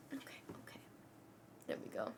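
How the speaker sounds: hushed whispered okays. Real, not acted. Owner settles her cat before smoking, whispered.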